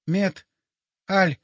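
broken syllables with long pauses; dying man forcing out fragments; struggling to speak. A narrator's reading. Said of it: "Он что-то попытался проговорить".

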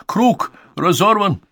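high, loud, drawn-out announcement; excited cry. A narrator's reading. Conan Doyle jumps up freed, excited cry. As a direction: call it out high and slow. Man announces the circle is broken.

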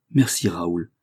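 ordinary delivery, nothing marked

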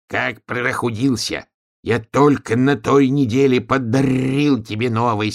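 high, slow protest, rolled r; indignant donkey-turned-wolf. A narrator's reading. Donkey disputing the leaky samovar, indignant growly r's. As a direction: object indignantly, drawing words out.

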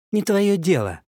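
high snappy retort; snapping back. A narrator's reading. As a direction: snap back at him. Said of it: "огрызнулась Вера".

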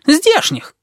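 high, sharp indignant cry. Acted. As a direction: snap it back, offended. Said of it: "возмутился шакал".

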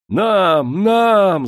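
громкий высокий крик; кричат наперебой, требуя; крик толпы. A narrator's reading. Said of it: "закричали все разом". (нищие кричат, требуя серебра, громко)

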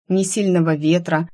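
ordinary delivery

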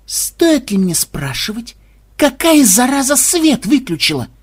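slow, high, icy menace; a chilling slow threat; cold rasping evil emperor. Acted. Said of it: "ледяным голосом осведомился Ксенобайт".

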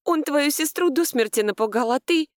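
high-pitched reproach, breaking off; indignant. A narrator's reading. Narrator defends sister to laughing husband, indignant. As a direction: reproach him, pitched well up. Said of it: "возмутилась я".

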